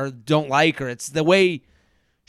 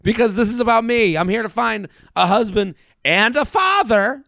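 fired-up confession; 5 semitones higher than usual. Retold or imitated. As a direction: say it proud and defiant, pitch up. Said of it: "when I saw Charity get the".

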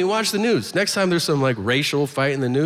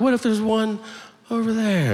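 worried objection; 8 semitones higher than usual. Acted. Acted worried objector in a quoted exchange.

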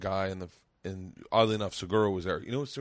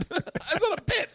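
very high, drawn-out mock protest; slow, pitched-up comic denial; laughing protest. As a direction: squeal it through laughter. Acting punchline while cracking up.

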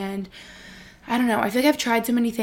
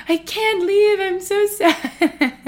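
mock wail, much higher and louder, drawn out slowly; dramatic whine; 10 semitones higher, 6 dB louder, much slower than usual. Vlogger mocks her own dramatic self, wailing. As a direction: ham up the heartbreak. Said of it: "I'm so dramatic".

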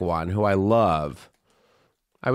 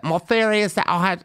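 higher-pitched put-on thick British accent; hammed-up British accent, pitched up; 8 semitones higher than usual. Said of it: "like, thickest accent".

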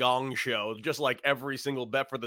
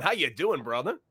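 ordinary talk

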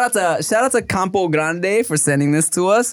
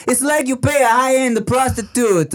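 hammed-up Italian accent, pitched up; singsong mock Italian accent; 4 semitones higher than usual. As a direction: explain it like an animated Italian.

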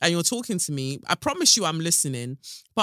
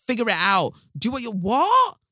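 mock dismissive parent; 6 semitones higher, 5 dB louder than usual. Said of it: "be like".

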